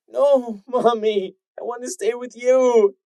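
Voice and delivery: high, loud tearful wail; a child crying not to be left. Acted. Filly wailing to stay with her mother. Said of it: "the filly wailed".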